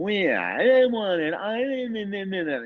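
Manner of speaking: high, drawn-out mock whine; whining kid. Imitating the kid next door in the hotel, whining. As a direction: whine wordlessly like a fussy child. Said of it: "a kid whining".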